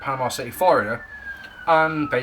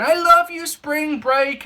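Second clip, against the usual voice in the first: put-on girly ad voice; 8 semitones higher, 3 dB louder, slower than usual. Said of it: "all these girls going".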